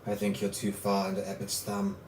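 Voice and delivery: a calm dark lord judging the hero; sinister villain. Acted. GM voices villain at length.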